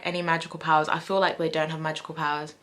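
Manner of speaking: ordinary talk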